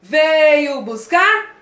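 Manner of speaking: loud, slow military chant; like a soldier. Shown as a demonstration. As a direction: bark each phrase like a drill soldier. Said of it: "você vai falar igual um soldado". (alto, como um soldado)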